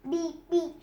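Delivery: plain reading voice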